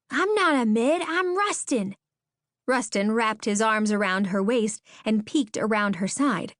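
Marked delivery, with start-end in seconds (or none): 0.1-2.0 put-on high child's voice, insistent; little boy. A narrator's reading. Small boy correcting the stranger, high child's voice.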